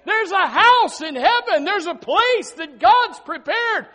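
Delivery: high, loud and slow proclamation; shepherds calling out. Retold or imitated. Shepherds shouting the good news to the crowd, loud and high. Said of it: "they're trying to tell you".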